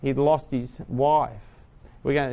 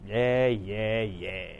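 bored brush-off; 6 semitones lower, 7 dB quieter, much slower than usual. Retold or imitated. Bored youth brushing off preacher.

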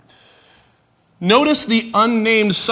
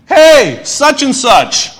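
loud, raised-pitch hail; calling out; 7 semitones higher, 7 dB louder, much slower than usual. Acted. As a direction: holler it to a man whose name you don't know. Calling out to unnamed man.

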